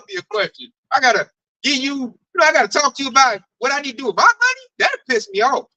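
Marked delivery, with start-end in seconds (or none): none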